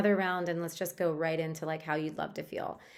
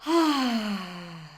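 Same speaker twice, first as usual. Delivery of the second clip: a loud, rising-then-falling ah of relief, let out long; relieved ah sigh; 2 semitones higher, 4 dB louder than usual. Shown as a demonstration. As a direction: breathe out an open ah like sinking into a warm bath. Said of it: "is to just go".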